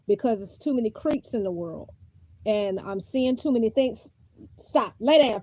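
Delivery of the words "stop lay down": stern scolding. Real, not acted. Mother ordering child to nap.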